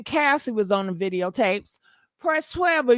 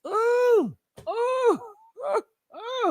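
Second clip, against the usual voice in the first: squealing oohs; 9 semitones higher, much slower than usual. Real, not acted. Relishing the [name] rumour, high squealing oohs. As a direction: squeal in scandalised glee.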